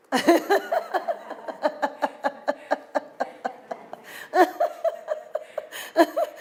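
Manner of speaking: long wheezing laugh; long breathless laugh. Real, not acted. Preacher laughing at how much she hated the training. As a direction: break into helpless laughter.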